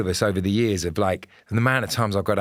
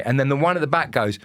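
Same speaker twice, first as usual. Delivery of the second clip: ordinary talk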